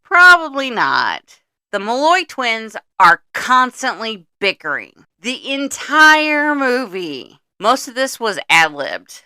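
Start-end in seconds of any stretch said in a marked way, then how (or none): 0.0-1.4 high, loud, slow mock voice; mock dismissive reply. Mocking producers dismissing a New York shoot. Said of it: "They're like". 5.2-7.4 high, loud and slow; emphatic. Real, not acted. Host stressing how long the twins bicker.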